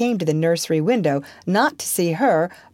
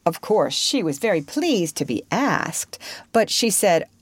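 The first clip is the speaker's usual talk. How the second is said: usual speaking voice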